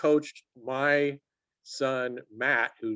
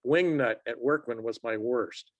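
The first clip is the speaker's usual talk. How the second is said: ordinary talk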